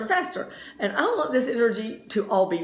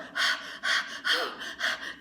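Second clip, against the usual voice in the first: long unvoiced exhale after a crash; heavy breath; 7 dB quieter than usual. Crashed skier gasping for breath, long unvoiced exhale. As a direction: blow out air like you are winded. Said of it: "And I'm breathing so hard".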